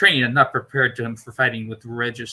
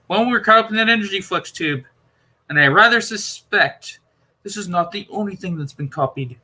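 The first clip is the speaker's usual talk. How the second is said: higher, eager Doctor voice, puzzled and insistent; 7 semitones higher than usual. Acted. Doctor explaining the console to Perry, puzzled and insistent. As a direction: play the Doctor working it out aloud.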